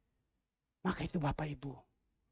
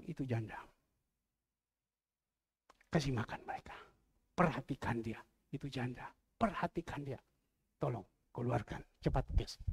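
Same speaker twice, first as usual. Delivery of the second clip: quiet voice of the Lord; 7 semitones lower, 7 dB quieter than usual. Acted. Pastor voices the Lord telling him to feed widows, low and quiet. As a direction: lower and softer, calm commanding voice.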